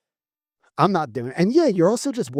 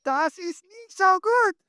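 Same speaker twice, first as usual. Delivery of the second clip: mock German accent; slower than usual.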